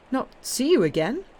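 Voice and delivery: high, loud cry of dismay. A narrator's reading. Emmanuel hearing the Count will never return, high loud cry. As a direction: exclaim it in shocked disbelief. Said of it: "Emmanuel cried".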